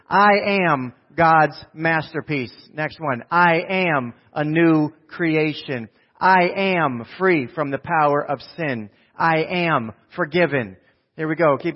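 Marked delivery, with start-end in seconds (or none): 0.1-2.7 slow, loud, word-by-word declaration; congregational declaration. Real, not acted. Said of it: "say it out loud with me". 3.3-10.8 slow, loud, word-by-word declaration; congregational declaration. Real, not acted. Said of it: "say it out loud with me".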